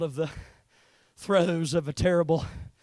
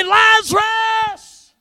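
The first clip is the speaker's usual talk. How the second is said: high, long-drawn call of a name; 14 dB louder, much slower than usual. Retold or imitated. Preacher voicing the call to Lazarus in the grave, calling out. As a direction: stretch the name out as a summons.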